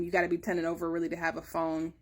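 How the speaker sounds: usual speaking voice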